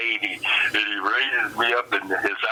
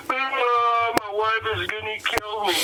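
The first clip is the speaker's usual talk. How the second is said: high, whiny mock sob; hammed-up crying, high and wailing; 11 semitones higher, slower than usual. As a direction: play the big guy blubbering to his friend. Crying Samoan quoted in story.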